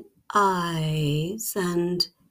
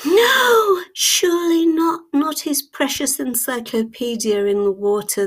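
shocked gasp; 7 semitones higher, 3 dB louder than usual. Acted. Narrator's shocked reaction.

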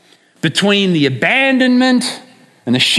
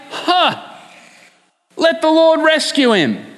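a scoffing sneer, pitched high; mocking taunt; 7 semitones higher than usual. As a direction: taunt the sufferer like a jeering crowd. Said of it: "they say".

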